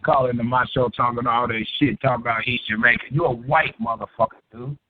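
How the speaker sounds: ordinary delivery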